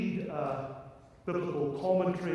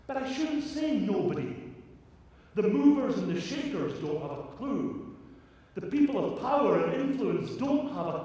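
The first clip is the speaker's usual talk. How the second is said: high, loud, fervent preaching; impassioned preaching; 6 semitones higher, 3 dB louder than usual. Acted. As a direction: preach it with rising fire.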